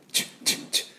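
soft unvoiced チッ close to チュ; kiss ch. Shown as a demonstration. Teacher showing a kiss-like チッ. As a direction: make チッ like a real kiss. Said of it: "チュに近いですね".

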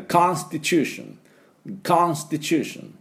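American English, wide open "KAHN" in Constitution; slow American model pronunciation; American accent. Shown as a demonstration. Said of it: "アメリカ人がこれ発音すると".

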